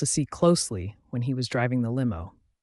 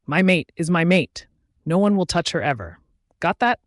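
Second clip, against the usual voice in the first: every word pressed hard and louder, higher on Got that; stressing every word; 4 semitones higher, 5 dB louder than usual. A narrator's reading. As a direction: spit each word out as a possessive warning. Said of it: "He stressed on every word".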